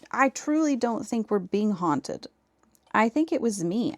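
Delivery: normal talking voice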